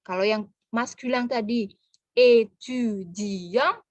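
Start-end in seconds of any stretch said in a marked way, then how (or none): 2.1-3.8 étudiant very slow, syllable by syllable; slow masculine étudiant; slow syllable model. Shown as a demonstration. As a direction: draw out étudiant. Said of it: "quatre syllabes".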